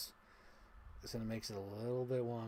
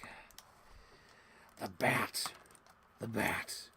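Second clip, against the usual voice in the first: gravelly impression; 2 semitones lower, much slower than usual. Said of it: "so he's like".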